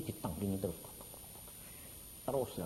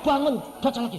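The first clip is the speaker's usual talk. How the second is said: mock command; 11 semitones higher, 7 dB louder than usual. Menirukan setan menyuruh bangun.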